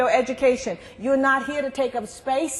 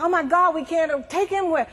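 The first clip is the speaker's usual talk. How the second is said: higher and louder, a retold disbelieving gasp; mock shocked outburst; 6 semitones higher, 4 dB louder than usual. Police learning every jail is full, mock shocked outburst. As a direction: play up the shock, half-joking. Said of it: "It's like".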